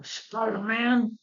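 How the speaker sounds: high, drawn-out villain cry; Green Goblin. Retold or imitated. Goblin going away for the day, long frustrated cry. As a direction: stretch the name out like a thwarted villain. Goblin leaves defeated, vowing.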